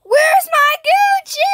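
very high, loud demand; high-pitched furious mock shout; outraged yelling. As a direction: screech it as an indignant demand. Demanding stolen Gucci back.

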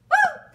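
high, loud joyful whoop; a loud, delighted cheer of joy; excited whoop. Real, not acted. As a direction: let out a big happy woo.

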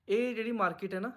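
normal talking voice

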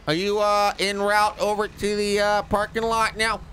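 high, cheery dimwit cop on the radio; high-pitched goofy radio voice; Jay Bergerman voice. Acted.

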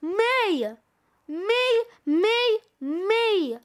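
high, loud, separate bursts; clamouring shouts. A narrator's reading. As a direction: shout it like rivals each claiming the prize. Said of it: "shout the Santas".

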